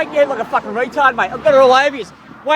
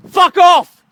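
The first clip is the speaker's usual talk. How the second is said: angry; 3 semitones higher, 5 dB louder than usual. Real, not acted. Angry man swearing at police.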